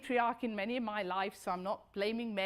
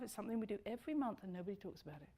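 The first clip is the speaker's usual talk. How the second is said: usual speaking voice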